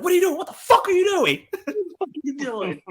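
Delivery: high disbelieving mock yell; hammed-up baffled outrage; incredulous. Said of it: "you're like".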